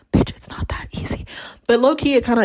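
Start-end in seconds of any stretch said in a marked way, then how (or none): 0.1-1.2 unvoiced whisper, as a muttered aside; whispered retort. Client grumbling back at the therapist's advice, whispered retort. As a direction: whisper a grumbled comeback under your breath.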